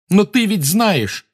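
high, loud slow shout. A narrator's reading. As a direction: shout at the elf. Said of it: "закричал Гарри".

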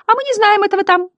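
very high, quick squeak; clown butterfly newcomers. A narrator's reading. Newcomers answer the others, very high clown voice. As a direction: squeak it out fast.

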